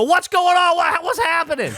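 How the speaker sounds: high, loud clamoring onlookers; crowd calling out. Retold or imitated. People behind asking what is going on, clamoring. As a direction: shout it high from the back of a crowd.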